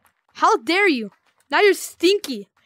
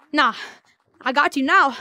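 ordinary talk, nothing marked

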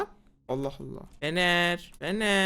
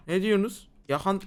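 ordinary talk, nothing marked

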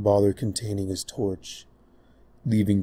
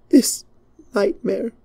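anguished princess; 13 semitones higher, 5 dB louder than usual. Acted. Princess after trembling breath.

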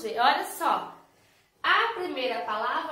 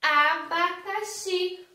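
"Abacaxi" slow, higher, every syllable long; stretched word demo; 5 semitones higher, 3 dB louder, much slower than usual. Shown as a demonstration. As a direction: draw the word out for kids to repeat. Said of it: "E esse aqui?".

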